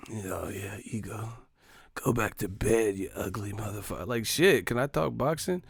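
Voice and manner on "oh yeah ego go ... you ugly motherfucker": hushed, breathy creep voice; mock lustful murmur; creepy fan. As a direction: whisper it like a leering fan. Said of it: "men have, like, weird fetishes with me".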